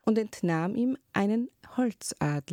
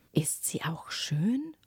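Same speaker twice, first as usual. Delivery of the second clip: curious whisper; slower than usual. Acted. Prince asking the old woman about the princess, whispering. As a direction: ask it hushed, almost secretly.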